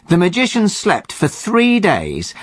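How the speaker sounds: ordinary talk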